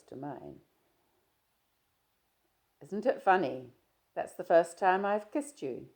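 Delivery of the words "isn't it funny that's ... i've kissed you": a louder, lightly voiced young man, breezy; Stephen, teasing. Stephen greeting his shy friend, easy and teasing. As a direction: play Stephen chatting easily, a touch louder.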